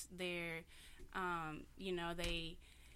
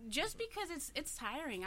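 teary and strained; crying; 8 semitones higher than usual. Real, not acted. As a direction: voice breaking up high. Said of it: "why are you crying?".

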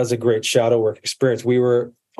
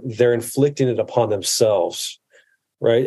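ordinary talk, nothing marked

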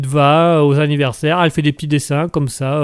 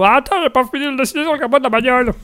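much higher, fast put-on voice; Mouton's squeaky voice; 8 semitones higher, faster than usual. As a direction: squeak it out hurriedly, busy drawing. Mouton voiced interrupting song.

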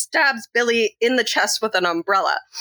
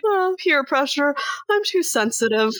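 high, pitiful goofball voice; mock whiny excuse; 7 semitones higher than usual. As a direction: imitate a feeble, self-pitying confession. Acting out Stu's whiny excuse.

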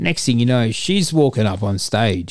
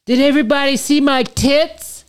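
put-on giddy girl voice; 4 dB louder than usual. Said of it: "she's like".

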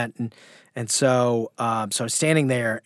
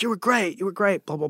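high, mock-gushing rave; breezy mock flattery trailing into blah blah; gushing praise; 6 semitones higher, faster than usual. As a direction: imitate her gushing over you. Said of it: "she was like".

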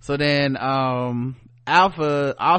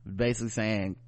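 normal talking voice

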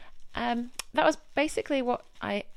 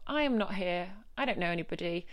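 ordinary talk

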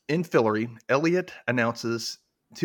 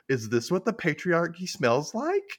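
sarcastic jab; 7 semitones higher, slower than usual. Retold or imitated. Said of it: "And she's like".

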